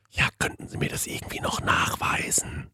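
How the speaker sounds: toneless whisper. Retold or imitated. Waitress asking for proof in the cinema, whispered. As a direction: ask for proof under your breath.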